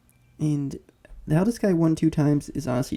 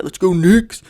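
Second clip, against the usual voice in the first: loud, higher team cheer; big loud rallying cry; cheering shout; 4 semitones higher, 13 dB louder, slower than usual. Real, not acted.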